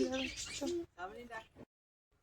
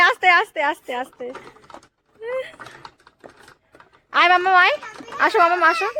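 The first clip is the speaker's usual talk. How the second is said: coaxing calls; 6 semitones higher, 15 dB louder than usual. Real, not acted. Coaxing a child on swing.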